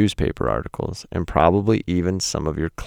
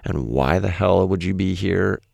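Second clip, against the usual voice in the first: usual speaking voice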